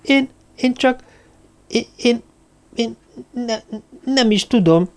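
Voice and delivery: high, stammering, scared voice; frightened stammer. A narrator's reading. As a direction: stutter it out in fright. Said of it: "A szája széle remegett a félelemtől". (rémült, magas hangú dadogás)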